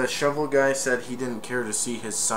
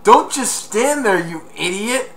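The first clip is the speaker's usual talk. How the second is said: a higher, loud, scolding bark of a voice; bossy big brother; 9 semitones higher, 7 dB louder than usual. Retold or imitated. Brother yelling at the burning burglar, high mock scold. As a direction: snap it at him like a fed-up sibling. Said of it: "My brother's like".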